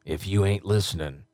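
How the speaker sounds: low, punchy promo read; low-pitched radio imaging voice; radio announcer. Acted. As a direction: deliver it like a station liner. Records promo liners in announcer voice.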